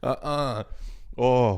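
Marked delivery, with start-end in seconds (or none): 1.2-1.6 OIL squashed into one syllable, like "ole"; loud, glide-less OIL that sounds like OH; dialect oil. Shown as a demonstration. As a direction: say OIL as a bare "ohl", punched out. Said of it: "That's a noise. That's not a word".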